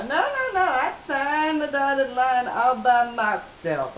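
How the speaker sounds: emphatic acted protest; indignant correction. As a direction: set them straight, stretching it out. Retells her indignant correction of him.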